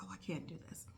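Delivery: soft voiceless whisper of defeat; exhausted whisper. Retold or imitated. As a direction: barely breathe the words out, worn down. Retelling misery with glue-filled lungs.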